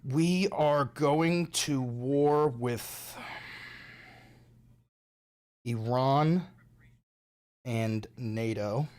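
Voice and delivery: very slow, drawn-out doom voice; dramatic slow announcement. Pretend. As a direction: stretch each word like a grim trailer.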